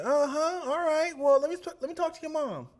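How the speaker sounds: imitating Miss Calhoun. Miss Calhoun asking for Dion's mom, high sweet imitation. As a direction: ask for mom in a sing-song tone. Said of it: "she was like".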